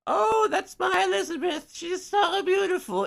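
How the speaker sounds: very high, loud put-on mother voice; imitated doting mother. Mother boasting to a reporter, mock falsetto gushing. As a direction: gush in a hammed-up falsetto as a proud mom. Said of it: "called her mom".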